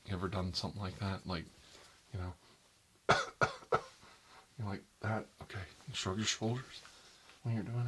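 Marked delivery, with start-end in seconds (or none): 3.1-3.9 vampire cough. Shown as a demonstration. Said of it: "vampire cough".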